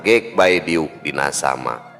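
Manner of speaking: plain reading voice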